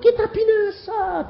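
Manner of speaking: very high, drawn-out mock wail; hammed-up plaintive cry; disciples' wail. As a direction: imitate helpless disciples, slow and high-pitched. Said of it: "jangan ngomongnya kayak murid-murid itu".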